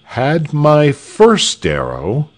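high, slow and weighty declaration; slow-building defiance; slow, deliberate. A narrator's reading. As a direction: draw each word out with resolve. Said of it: "said Tell slowly".

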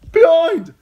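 loud high yell. A narrator's reading. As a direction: yell a warning. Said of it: "Hot Pie yelled".

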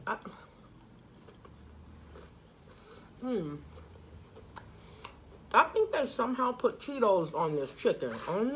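chewing while talking; savoring a bite; mouth full. Real, not acted. Seen 1: Bites chicken at -3; jaw keeps working through early words.

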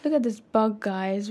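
ordinary talk

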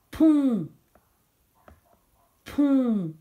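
"pont" loud, long and falling; dictated syllable. Shown as a demonstration. Teacher gives pupils another syllable to write, loud drawn-out "pont". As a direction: call out "pont" twice, each one held and separate. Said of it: "la syllabe".

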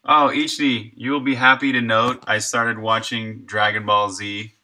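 ordinary delivery

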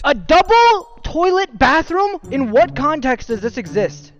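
high, slow disbelief; horrified at a double toilet bathroom; shocked. Real, not acted. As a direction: stretch it out, appalled.

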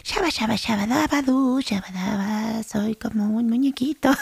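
high, silly little whispery voice; mock ASMR voice. Host parodies ASMR nonsense for listeners, playful high soft voice. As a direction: babble softly and high, hamming up ASMR nonsense. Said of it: "de esos audios que graban así de que".

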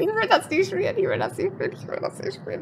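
whimpering, fading to a whisper; frightened pleading that dies away; scared horror game streamer. Real, not acted. Repeats the line, fading out.

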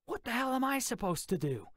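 panic, rattle it off high; panicked cry. Acted. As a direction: Tubb panicking at his orders, fast and high. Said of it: "Tubb cried".

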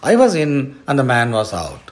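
quick, impatient correction; exasperated lion explaining in a hurry. A narrator's reading. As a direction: set them straight, fast. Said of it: "said the lion impatiently".

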